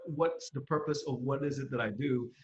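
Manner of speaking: ordinary delivery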